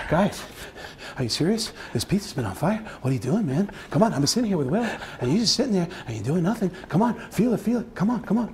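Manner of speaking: out of breath, complaining in short puffs; panting plea, pitch climbing toward the end; Mark Wahlberg. Acted. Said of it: "out of breath Mark Wahlberg".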